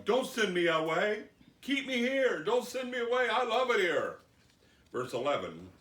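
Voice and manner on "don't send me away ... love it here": high, loud, hammed-up pleading; begging. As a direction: beg at the top of your voice to stay. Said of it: "They begged him".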